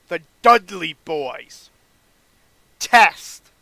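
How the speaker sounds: loud, drawn-out announcer calls; draft announcement. Acted. Paul Heyman announcing SmackDown picks, loud announcement. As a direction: boom out each pick, stretching the names.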